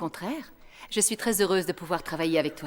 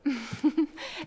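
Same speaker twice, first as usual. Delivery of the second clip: knowing laugh; 6 semitones higher, 3 dB quieter than usual. Acted. Teacher laughs at confiscation.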